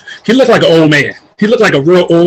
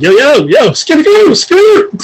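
high, climbing call to a colleague; playful high hailing call; 11 semitones higher than usual. Retold or imitated. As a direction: call someone over, pitch leaping into falsetto. Retold calling Frankie over.

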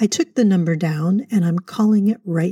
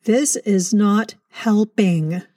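slow exasperated; 3 semitones higher, much slower than usual. Acted. Frustrated caller.

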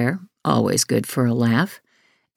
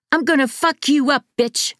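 put-on boy's enraged screaming threat; screamed threat; 12 semitones higher than usual. A narrator's reading. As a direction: scream it at him in fury. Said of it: "Devon screamed".

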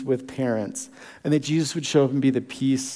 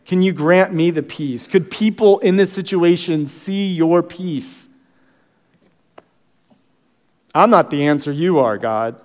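a lightly raised, pleading prayer to God; earnest prayer; 2 semitones higher than usual. Acted. Pastor praying aloud before the congregation, earnest plea.

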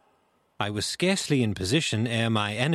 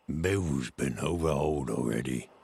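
much lower, duller sailor's voice; low-voiced pirate; 7 semitones lower than usual. A narrator's reading. Searcher at inn door to blind man, low reply. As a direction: report it low, in contrast to the shouting.

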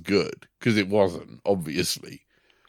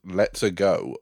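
ordinary talk

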